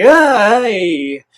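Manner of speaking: sudden high loud yelp then a gruff grunt; hammed-up monster yelp; beast cry. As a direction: cry out like a hit fox demon. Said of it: "Kurama is like".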